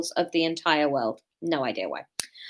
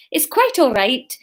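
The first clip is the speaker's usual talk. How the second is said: cheery Scottish character voice; Scottish accent; 4 semitones higher, 6 dB louder than usual. A narrator's reading. Mortglade reassuring Marine, cheery Scottish accent. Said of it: "Scottish worked as well".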